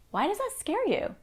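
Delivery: a touch of accusation in the why; high, probing parent voice; accusatory question. Shown as a demonstration. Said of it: "trying to sound accusatory".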